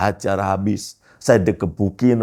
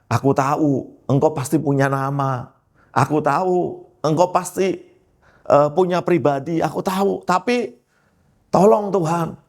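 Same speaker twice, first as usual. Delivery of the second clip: pleading with God, pitched high; a retold night prayer rising into an urgent plea; implore, voice climbing as the plea goes on; earnest prayer; 7 semitones higher than usual. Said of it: "itu doa saya setiap malam".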